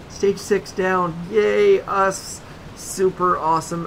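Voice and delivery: high, loud slow cheer; hammed-up victory cheer; mock cheer. Real, not acted. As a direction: celebrate it with a wink. Sarcastic celebration after a win.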